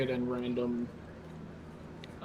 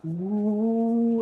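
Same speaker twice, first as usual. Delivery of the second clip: put-on high kids' ooh; a crowd of kids going ooh; excited ooh; 9 semitones higher, 3 dB louder than usual. Children reacting to minstrel song.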